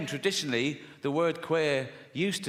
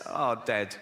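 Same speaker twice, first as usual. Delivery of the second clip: ordinary talk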